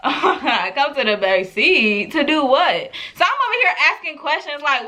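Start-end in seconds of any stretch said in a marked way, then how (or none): 0.0-2.9 slow, lower-pitched, laughing disbelief; tipsy laughing question. Retold or imitated. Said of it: "I was drunk, so I was like".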